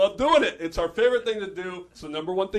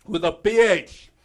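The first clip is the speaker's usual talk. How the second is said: ordinary talk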